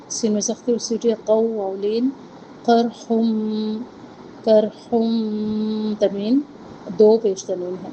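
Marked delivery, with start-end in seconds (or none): 2.6-5.9 کرحم very slow with thick qaf and ra; heavy qaf and ra. Shown as a demonstration. Teacher models the heavy letters again, very slow. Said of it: "قاف اور را دونوں کو موٹا پڑھنا ہے".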